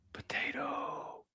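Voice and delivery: high soft whisper, slowly. Real, not acted. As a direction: breathe the name softly and high. Whispering to the dog.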